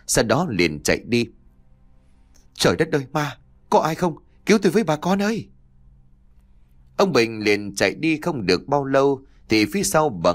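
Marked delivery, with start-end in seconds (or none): 2.5-5.5 high-pitched panicked cry for help; terrified scream. A narrator's reading. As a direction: cry out to the neighbours in fright. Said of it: "thì hét lên một tiếng".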